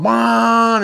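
short high engine whine; high-pitched boat noise; boat taking off. Retold or imitated. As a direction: imitate a motor zipping away. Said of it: "instead of the boat going".

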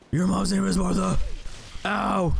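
hammed-up Batman growl, slowed; mock superhero gravitas over nothing; gruff mock Batman. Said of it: "talk in the Batman voice".

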